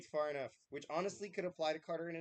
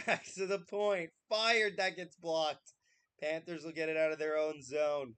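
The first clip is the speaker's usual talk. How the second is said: play-by-play; 8 dB louder, slower than usual. Acted. Mock play-by-play call.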